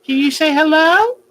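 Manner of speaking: high, sing-song coaxing of a pet; high coaxing pet talk; baby talk to a bird. Acted. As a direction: coax the bird sweetly, pitch up.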